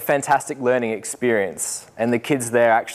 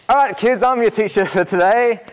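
teacher voice; 10 semitones higher, 4 dB louder than usual. Retold or imitated. Stepping up to lead class.

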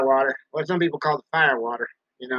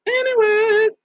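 silly squeak; 3 dB louder, slower than usual. Acted.

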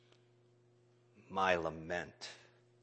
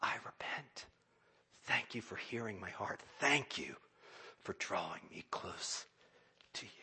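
soft, quiet repentance; humble whispered prayer; 2 semitones lower, 10 dB quieter than usual. Retold or imitated. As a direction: pray it barely aloud. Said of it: "to say".

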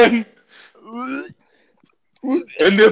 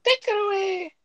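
higher, hammed-up villain call; high mock order; 10 semitones higher, 9 dB quieter than usual. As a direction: bark it like a cartoon villain. Third take of the line.

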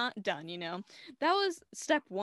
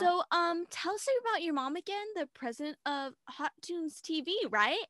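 sly Tess; 8 semitones higher, 3 dB louder than usual. Retold or imitated. Tess baiting Mitchie, high sly mock voice. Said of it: "Tess is like".

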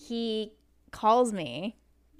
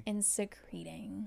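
whispered aside; 9 dB quieter, much slower than usual. Real, not acted. Disgusted aside about sweaty guy.

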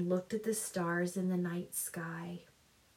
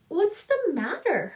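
high, sharp impatient question; 9 semitones higher, 5 dB louder than usual. A narrator's reading. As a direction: snap it out impatiently. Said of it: "stamped impatiently".

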